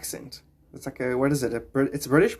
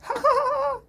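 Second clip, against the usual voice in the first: high laugh. Real, not acted. Laughs at teacher quote.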